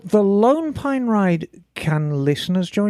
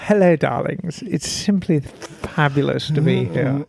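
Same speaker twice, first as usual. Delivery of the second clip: campy drag queen; 6 semitones lower than usual. Pretend. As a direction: purr it like a drag diva. [name] greets, campy.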